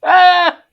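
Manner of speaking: a loud high shriek; sharp high shout of alarm; startled scream. Real, not acted. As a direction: yelp in sudden panic. Lag spike startles him.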